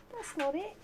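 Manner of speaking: high sing-song to a dog; pet voice. Real, not acted. Owner correcting the dog, high sing-song. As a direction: lift it up like talking to a puppy.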